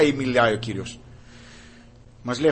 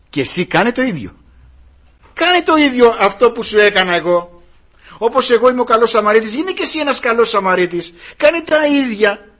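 high, loud rebuke from the pulpit; fiery preaching; 10 semitones higher, 10 dB louder than usual. Acted. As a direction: hammer the congregation with every question. Preaches at full force. (φλογερό κήρυγμα, δυνατά και ψηλά)

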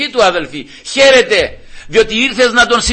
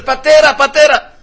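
high, raised repeated call; 8 semitones higher, 5 dB louder than usual. Acted. Calling father to a man passing outside, calling out. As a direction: hail a passer-by from the doorway. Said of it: "ακούει κάποιον να φωνάζει".

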